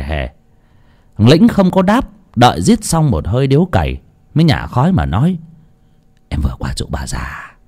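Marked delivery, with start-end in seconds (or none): 6.3-7.6 low, soft, emotionless report; unmoved. Acted. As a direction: deliver the death news without grief. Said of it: "nói rừng rưng như không có chút gì đau buồn".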